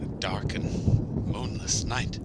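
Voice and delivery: scared, slow, hushed mumble; nervous in the dark; nervous whisper. Acted. Crane alone, frightened in dark.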